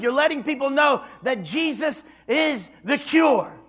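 climbing to loud, high shouts; preaching at full force. Acted. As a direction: build it up until the church rises.

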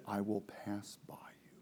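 breathy whispered voice of God; hushed whisper. Acted. Teacher whispers God's words to the class. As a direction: whisper it like a holy secret.

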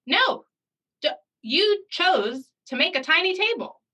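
high, firm correction of a student; mock scolding.

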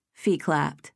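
ordinary delivery, nothing marked

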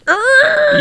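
high-pitched screeching noise; imitated screech. Co-host mimics the title screeching onto the screen, screech. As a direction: screech it out like tires skidding. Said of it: "it was like".

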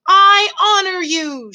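high, slow, loud declaration; shouted. A narrator's reading. Mouse honouring the princess, shouting. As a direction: proclaim it in a drawn-out shout. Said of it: "shouted Despreau".

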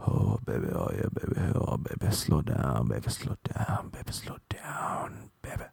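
unvoiced low whisper, fading; whispered seduction. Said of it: "And then he just".

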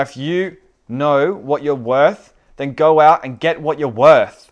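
loud, forceful fatherly speech, building; impassioned pep talk. Acted. Father urging his son to keep moving forward, impassioned.

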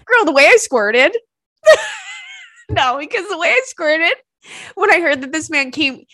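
loud, high cackling; shrieking laughter. Real, not acted. Telling the story to listeners, loud high shrieking laughter. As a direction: crack up shrieking.

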